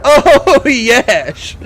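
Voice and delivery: high, loud laughing yes; delighted cheer. Real, not acted. Gamer cheering a lucky shot. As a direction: cheer the hit. Another kill celebrated.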